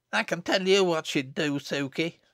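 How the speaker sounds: put-on high, knowing little girl; hint at a secret, up high. Little Jennet teasing to Susan, high and knowing.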